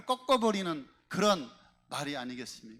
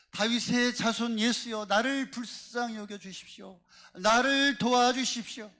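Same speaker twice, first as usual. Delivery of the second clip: higher, louder plea, stretched out at the end; acted loud cry for help; crying out for mercy; 5 semitones higher, 4 dB louder than usual. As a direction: call out desperately to Jesus, as the pleading woman. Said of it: "지금 소리를 지르고".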